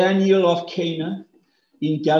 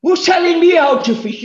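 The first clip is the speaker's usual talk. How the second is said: higher, louder, bristling shout; indignant Peter; 10 semitones higher, 6 dB louder, faster than usual. Retold or imitated. Peter bristles at the stranger's advice, indignant shout. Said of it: "He must have thought".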